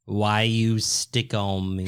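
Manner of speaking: low, slow teasing imitation; mocking crackly-voice impression. Teasing the co-host's crackly voice, low mocking imitation. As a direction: imitate your friend's croaky voice, drawn out. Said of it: "that's what you sound like".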